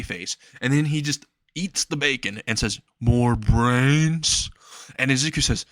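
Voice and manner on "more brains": slow, lowered zombie groan of a question; a zombie pleading for brains. Acted. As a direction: moan it like a hungry zombie. Zombie friend asks for more.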